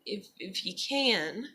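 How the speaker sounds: soft, slow, hesitant asking; meek request. Retold or imitated. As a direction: ask timidly, as if not to impose. Meek request contrasted with forcing someone.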